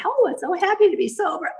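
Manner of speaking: high, sugary mock-sweet voice; hammed-up saccharine chirp; prissy sober woman. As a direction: parody the perfectly manicured lady.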